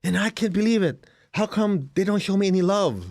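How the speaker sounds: mock wounded complainer; whiny self-pity. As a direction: play the hurt complainer for laughs. Mocking a self-pitying complainer.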